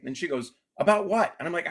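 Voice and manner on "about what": much higher, louder mock wife; wife retort. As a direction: toss it back sharply.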